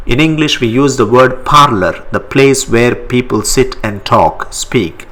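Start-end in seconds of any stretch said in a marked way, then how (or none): none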